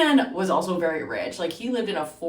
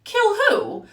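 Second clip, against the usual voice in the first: high, loud startled squeak; shocked; 6 dB louder than usual. Retold or imitated. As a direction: blurt it out in shock. Shocked inner thought.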